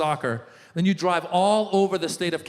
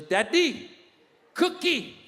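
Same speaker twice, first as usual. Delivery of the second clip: put-on high-pitched toddler request; short, high baby talk; little girl voice; 9 semitones higher, slower than usual. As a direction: ask like a tiny daughter. Voicing his toddler daughter.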